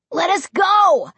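high-pitched, drawn-out plea; desperate cry. A narrator's reading. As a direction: cry out to be released. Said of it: "Josh cried".

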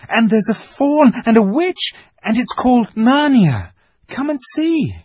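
put-on high, loud, eager girl; bursting to share a secret; excited girl. A narrator's reading. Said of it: "Lucy was so excited".

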